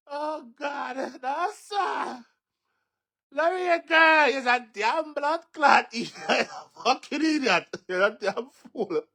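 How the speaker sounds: high-pitched, helpless laughing voice; insults squealed mid-laughing fit. Real, not acted. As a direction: squeeze the words out through laughter. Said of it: "right now I'm laughing at you".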